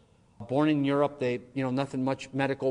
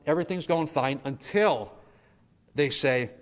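usual speaking voice

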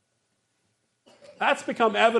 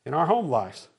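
ordinary delivery

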